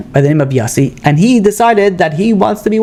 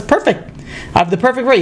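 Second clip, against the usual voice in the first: higher and softer, delighted; a pleased little lift on perfect; pleased rabbi; 6 semitones higher, 4 dB quieter than usual. Retold or imitated. Rabbi Abba delighted by the rich man's offer, higher pitch.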